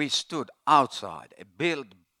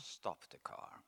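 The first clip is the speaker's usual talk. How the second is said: quiet firm command; 8 semitones lower, 16 dB quieter, slower than usual. Retold or imitated. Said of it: "my wife said".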